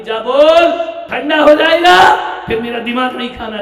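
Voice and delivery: high, loud mock yell; exasperated mother shout. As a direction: yell like a fed-up wife.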